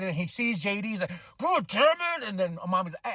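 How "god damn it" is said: high-pitched mock outburst of anger; mad. Recapping a wrestler getting mad backstage, angry imitation. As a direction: burst out in retold exasperation. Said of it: "Damien's getting mad".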